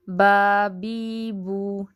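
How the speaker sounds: each syllable drawn out slowly; teacher's slow recitation drill. Shown as a demonstration. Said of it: "बा खड़ी ज़बर बा".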